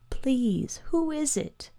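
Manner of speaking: put-on high, hushed child's plea; small, frightened, tearful voice; quiet fearful weeping. A narrator's reading. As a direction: plead softly as a boy choked with fear. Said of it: "the boy wept quietly, choked with fear".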